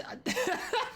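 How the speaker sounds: very high breathy giggle; high squeaky laugh. Real, not acted. Laughing at the wordplay.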